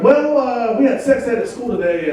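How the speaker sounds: a hint of a boy owning up; son confessing. Son explaining to dad what happened at school, confessing.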